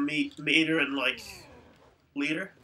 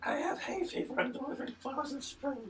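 high joking voice; 9 semitones higher, 5 dB quieter than usual. Pretend. Joking about hay fever.

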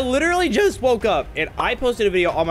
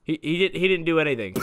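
usual speaking voice

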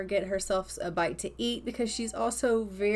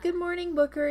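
lightly higher sweet mom greeting; voicing the mom; 9 semitones higher than usual. Gamer voicing her Sim mom greeting her son, light high cheer. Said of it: "And she's like".

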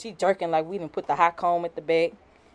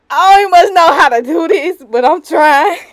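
nervous; 8 semitones higher, 13 dB louder than usual. Real, not acted. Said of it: "I'm nervous".

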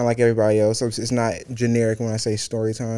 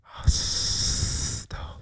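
ordinary talk, nothing marked

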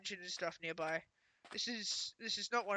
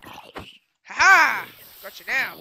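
gloating laugh; 16 dB louder, much slower than usual. Real, not acted. Taunting mob again.

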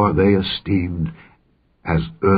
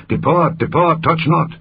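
urgent cry; 8 semitones higher than usual. A narrator's reading. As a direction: read as a raised, higher warning cry. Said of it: "They cried unto them".

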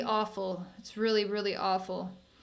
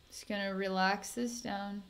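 soft, calming murmur to an upset client; soothing; 6 dB quieter than usual. Real, not acted. Healer calming her upset client, soft and soothing. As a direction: settle them down gently, a little softer.

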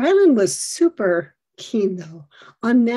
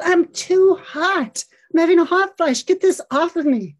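retold panic; 7 semitones higher, 4 dB louder than usual. Son to mom, overheating in the mall, panicky.

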